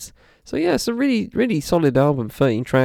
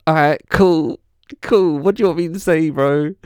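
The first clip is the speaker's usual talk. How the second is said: higher, louder fed-up quote; mock exasperated; 5 semitones higher, 3 dB louder than usual. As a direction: throw up your hands, annoyed. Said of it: "it's like".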